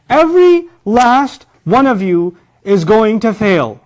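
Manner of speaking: loud, high, slow pronouncement; stern teacher. Acted. Teacher warns students they will fail, loud and emphatic. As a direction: hammer each word at the class. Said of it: "I say that to students".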